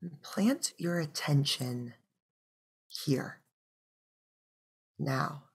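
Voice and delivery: unhurried meditation voice, long pauses between phrases; guided meditation. Real, not acted. Teacher guiding listeners with closed eyes into breath, calm meditation voice.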